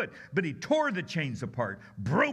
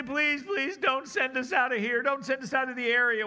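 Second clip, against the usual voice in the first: higher, louder begging; pleading demons; 8 semitones higher, 4 dB louder than usual. Retold or imitated. Demons begging Jesus not to send them away, higher and pleading. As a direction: whine to stay put. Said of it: "And then the demons say".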